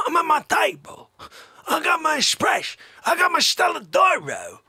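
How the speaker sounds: hammed-up demanding customer, swinging from yells to murmurs; Fache. Plays Fache at his table.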